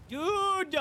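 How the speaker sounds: high, loud drawn-out dude; exasperated shout. Real, not acted. Player hit by the bear from far away, exasperated shout. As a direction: protest the unfair hit.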